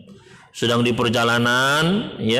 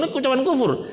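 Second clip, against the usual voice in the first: usual speaking voice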